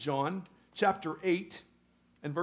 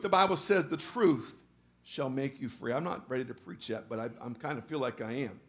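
ordinary talk, nothing marked

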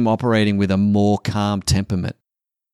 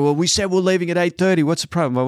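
higher, fast, indignant insistence; indignant past self; 8 semitones higher, faster than usual. Retold or imitated. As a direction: defend the schedule like it's obvious. His past self defending the plan.

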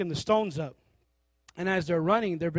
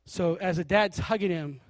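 ordinary talk

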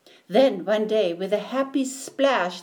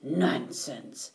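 low, softer scoffing dismissal; dismissive; 6 semitones lower, 5 dB quieter than usual. A narrator's reading. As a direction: brush it off as silly. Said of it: "said the minnow".

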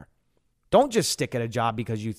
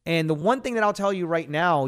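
normal talking voice

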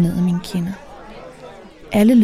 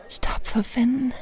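slow, hushed, higher-pitched protest; whisper; 5 semitones higher, 6 dB quieter, slower than usual. A narrator's reading. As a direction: whisper it urgently but slowly. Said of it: "hvisker jeg". (indtrængende, langsom hvisken)

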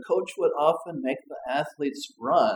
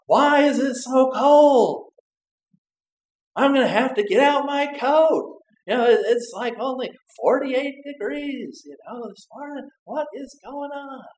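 grumpy complaining; 10 semitones higher, 4 dB louder than usual. Acted. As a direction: whine about the cold like a grouch. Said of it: "they said".

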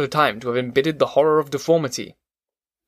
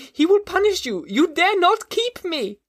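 put-on very high, shrill child's voice hurling insults; a frantic little boy screaming for release; terrified child. A narrator's reading. Boy fighting the monster's grip, screaming insults in high panic. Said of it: "he cried".

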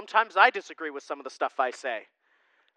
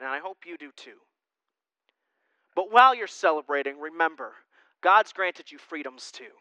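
normal talking voice

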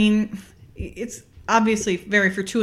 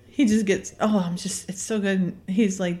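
ordinary delivery

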